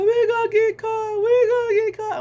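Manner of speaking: high, loud panicky whine; freaked-out sister. Retold or imitated. Sister freaking out that they'll get caught, high panicked whine. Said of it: "she freaked out".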